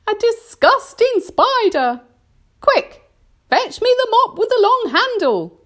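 high, loud, disgusted outburst; shrieked order, urgent and revolted; horrified cry. A narrator's reading. Aunt Sponge demands a mop for the spider, crying out. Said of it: "she cried".

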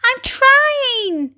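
high, plaintive glowworm voice; poor glowworm. A narrator's reading. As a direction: plead in a high little voice. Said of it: "answered the poor glowworm".

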